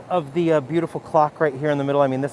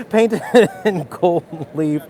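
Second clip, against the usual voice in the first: laughing; 5 semitones higher, 4 dB louder, slower than usual. Real, not acted. Laughing after the hello pun.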